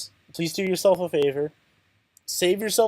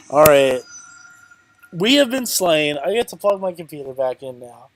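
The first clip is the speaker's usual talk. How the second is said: ordinary delivery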